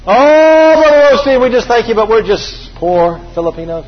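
high, loud fawning "Oh", then settling; hammed-up grovelling thanks; gushing praise. Rudy fawning over Brother Osteen, loud and high.

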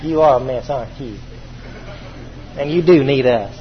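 low, soft, patronizing coo; mock pity. Mocking condescending pity toward a poor Filipino, soft. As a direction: pat them on the head with your voice. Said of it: "It's not always proper just to say".

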